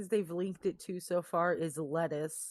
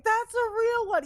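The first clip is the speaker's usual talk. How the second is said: excited praise; 3 dB louder than usual. Retold or imitated. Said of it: "all of us were like".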